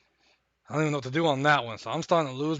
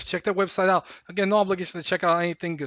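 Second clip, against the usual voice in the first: ordinary talk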